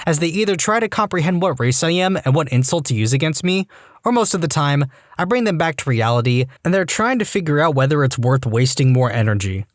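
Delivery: plain reading voice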